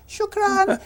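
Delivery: high, sharp Arabic "Shukran"; Arabic thanks. Retold or imitated. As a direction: say "Shukran" like a nervous thank-you.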